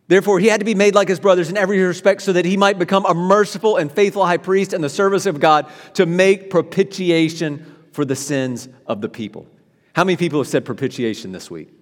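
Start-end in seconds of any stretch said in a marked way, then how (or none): 0.1-9.5 brisk, raised reading of the verse; scripture reading. Shown as a demonstration. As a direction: read the verse out fast and lifted. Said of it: "verse seventeen".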